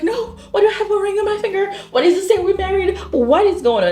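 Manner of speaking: high, laughing mock disbelief; baffled. [name] questioning Xavier about the ring, high mock disbelief.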